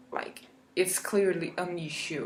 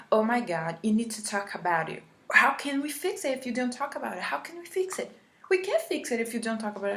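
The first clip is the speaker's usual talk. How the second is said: higher and fast, exasperated mock pleading; mock pushy therapist; 3 semitones higher, faster than usual. Imitating the therapist pressuring her to open up, hammed-up and fast. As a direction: rattle it off like a frantic, pushy counsellor. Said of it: "in this tone, in this demeanor".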